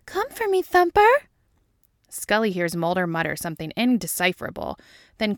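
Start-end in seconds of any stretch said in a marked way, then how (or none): 0.0-1.3 very high put-on voice, rising louder; hammed-up yell. A narrator's reading. Dana to her lover next door, ecstatic yell. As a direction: squeal it out, building to a yell. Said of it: "Dana yells".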